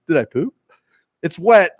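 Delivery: usual speaking voice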